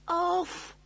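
high, loud acted scream; screamed command. A narrator's reading. Computer desperate to switch off, screaming. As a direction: scream the order out. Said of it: "he screamed".

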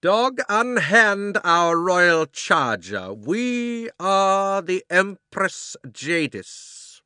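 very slow, loud and high royal decree; cold ringing command. A narrator's reading. Empress Jadis commands the cabby, loud, slow and cold. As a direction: ring out above the crowd like an empress. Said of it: "came her cold, clear voice, ringing loud".

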